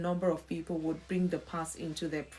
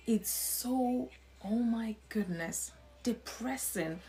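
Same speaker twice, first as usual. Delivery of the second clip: hushed, breath-only exclamation; dismayed whisper; 2 semitones higher, 4 dB quieter, slower than usual. Real, not acted. Dismayed aside about clients' negativity.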